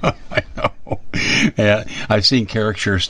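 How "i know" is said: laughing. Real, not acted. Laughing at the joke.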